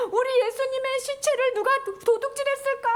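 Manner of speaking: high-pitched tearful wail; acted crying woman, voice pitched right up; mock weeping. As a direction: wail it as if sobbing over a loss. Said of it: "그래서 막 울면서".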